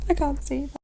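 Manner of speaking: laughing. Real, not acted. Reader refusing a rude word, laughing quietly. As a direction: laugh it off as you stop.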